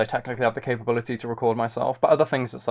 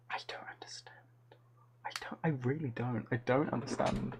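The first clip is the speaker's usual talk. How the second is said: whispered disbelief; baffled whisper; 4 semitones lower, 15 dB quieter, faster than usual. Real, not acted. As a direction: breathe it out in despair.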